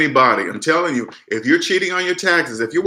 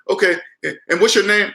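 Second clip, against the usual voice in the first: higher mock Indian accent; imitated Indian accent; 6 semitones higher than usual. Scammer asking the caller's name, imitated Indian accent. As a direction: lightly imitate an Indian phone agent. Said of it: "you hear that Indian voice".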